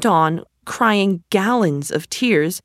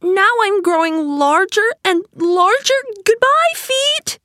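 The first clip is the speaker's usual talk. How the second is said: put-on excited girl; 13 semitones higher, 3 dB louder than usual. A narrator's reading. Alice growing huge, calling out.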